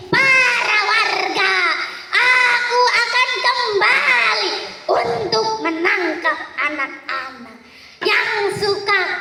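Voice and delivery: high, cackling witch voice; evil old witch warning, slow at the start; witch's parting threat. A narrator's reading. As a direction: threaten the villagers with glee. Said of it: "ia meninggalkan satu pesan".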